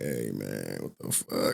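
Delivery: very soft, low, near-whispered innocence; mock innocent voice. Streamer imitating the guest's innocent voice, hushed and mocking. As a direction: imitate an innocent voice under your breath. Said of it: "it's that innocent voice".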